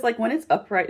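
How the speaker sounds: usual speaking voice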